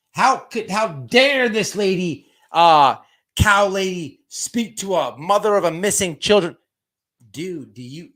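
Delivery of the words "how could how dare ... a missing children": loud, raised-pitch imitation of outrage; mock indignation. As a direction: mimic someone scandalized on another's behalf. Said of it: "then you're like".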